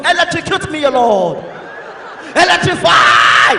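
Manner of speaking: very loud, hammed-up prayer shout; mock fervent prayer. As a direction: belt it out, stretching the last word. Parodies educated people praying loudly.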